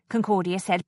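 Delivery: plain reading voice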